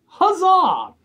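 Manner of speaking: loud, high hammed-up cheer; theatrical huzzah; mock cheer. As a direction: cry it like a jester.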